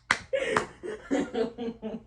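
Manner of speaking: quiet little chuckle; soft laugh. Real, not acted. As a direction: laugh softly, almost to yourself. Laughing after the question.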